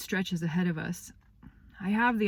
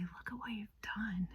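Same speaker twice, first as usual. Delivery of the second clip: whispered gently with pride; tender whisper; 4 semitones higher, 13 dB quieter than usual. Shown as a demonstration. Grown-up telling a child they did well, whispered tenderly. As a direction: breathe it as soft praise. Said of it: "in such a great way".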